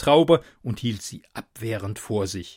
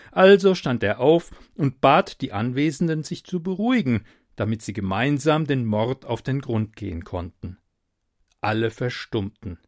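ordinary delivery, nothing marked

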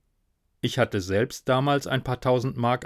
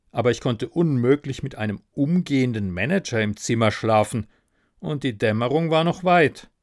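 plain reading voice

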